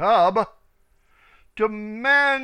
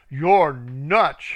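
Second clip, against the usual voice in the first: sassy reply; 8 dB louder than usual. A narrator's reading. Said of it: "her son replied".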